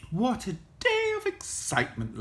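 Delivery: high, lightly amused granny voice; a touch of laughter, pitched high; laughing granny. A narrator's reading. Granny relieved after the wolf's escape, high amused voice. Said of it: "laughed Granny".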